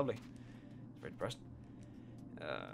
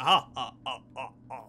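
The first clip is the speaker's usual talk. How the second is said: imitating a loud then fading fake laugh; mock laugh; 6 dB louder than usual. Mocking a character who just sits and laughs, fake laughter. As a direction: ham up a smug cackle. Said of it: "Just like".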